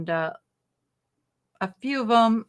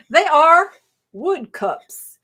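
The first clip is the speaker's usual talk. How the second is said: put-on slow, loud, higher-pitched Southern accent; 6 semitones higher, 7 dB louder, much slower than usual. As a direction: stretch the words out in playful Southern. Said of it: "go into my Southern accent".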